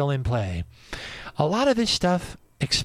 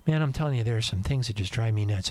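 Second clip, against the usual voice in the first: ordinary delivery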